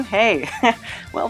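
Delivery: normal talking voice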